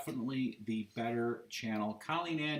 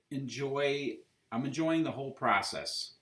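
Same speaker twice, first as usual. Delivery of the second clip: ordinary delivery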